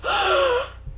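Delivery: high sharp mock gasp; a hammed-up shocked gasp; surprised gasp. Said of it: "There was a surprised gasp".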